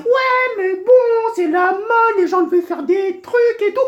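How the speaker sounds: very high-pitched mocking voice; exaggerated high parody of fashion followers; mocking naysayers. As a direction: mimic silly people making excuses, squeaky high. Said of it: "il y a des gens qui vont dire".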